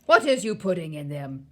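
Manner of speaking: loud giant questioning; booming question; shouted demand. A narrator's reading. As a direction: demand an answer forcefully. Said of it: "he shouted".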